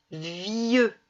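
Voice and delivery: "Vieux" slowly, long i gliding into eu; drawn-out syllable. Shown as a demonstration. Teacher saying the word so pupils hear ieux, drawn out. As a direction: stretch the i in "Vieux". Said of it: "J'entends ieux".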